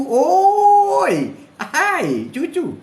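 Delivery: a loud, high call across the room. Pretend. Grandfather calling out to his grandchild, high and loud. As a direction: hail the grandchild from afar.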